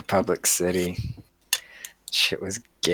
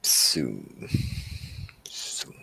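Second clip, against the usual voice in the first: dark lord; 8 semitones lower, 6 dB quieter than usual. Acted. Villain voice bit.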